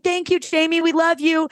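high singsong group thanks; imitated crowd. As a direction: voice a room full of adoring fans. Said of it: "everyone's like".

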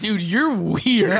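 high, slow, laughing imitation of a cop; laughing mock cop. Cop to the confused naked man, laughing mockery. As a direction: draw it out through giggles, mocking. Mimics cops to victim while laughing.

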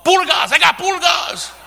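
high, loud mock announcement; comic warning shout; acted yell. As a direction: yell out the affliction to everyone nearby. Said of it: "They had to yell out what they had".

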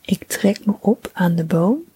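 ordinary delivery

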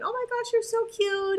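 very high, gushing pet voice; baby talk. Acted. Owner fussing over an overexcited puppy, high baby talk. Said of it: "we don't want a baby talk".

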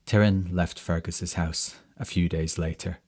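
ordinary delivery, nothing marked

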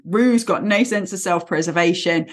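ordinary delivery

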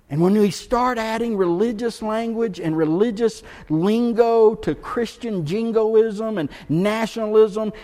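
full-force preaching. Acted. Pastor warning his church, preaching at full force. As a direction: preach it hard to the congregation.

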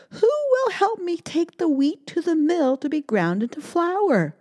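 high, pleading hen. Acted. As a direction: ask like a hopeful hen. Hen asks friends.

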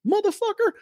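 very high-pitched muttered curse; mock exasperation. Man cursing his buddy for volunteering him, high mock exasperation. Retold reaction to being volunteered.

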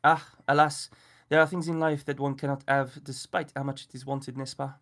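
put-on fussy French gentleman, refined French accent. A narrator's reading. Miller refuses the birthday boy in French accent. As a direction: turn the boy away in a prim French accent. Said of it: "a strange Frenchman in the Wendy house".

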